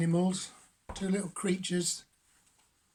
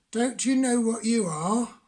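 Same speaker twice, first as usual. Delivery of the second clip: high, loud frog voice; a lively frog calling out; 5 semitones higher, 5 dB louder than usual. Acted. Frog asks Beaky.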